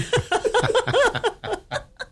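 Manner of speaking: a long, high laugh at the punchline; high burst of laughter. Real, not acted. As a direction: crack up at your own story.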